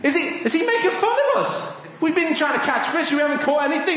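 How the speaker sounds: high-pitched, quickening mock indignation; indignant fishermen. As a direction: protest as the offended fishermen. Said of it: "Maybe they were thinking a bit like that".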